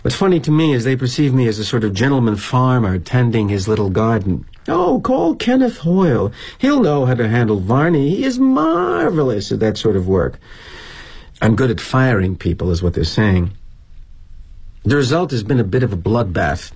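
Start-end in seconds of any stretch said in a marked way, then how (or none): 4.7-10.4 gushing colleague. Acted. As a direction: gush in a mock admiring voice. Colleagues' praise voiced in story.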